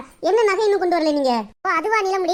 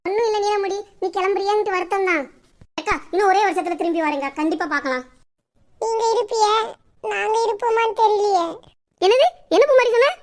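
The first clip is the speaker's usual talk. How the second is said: ordinary delivery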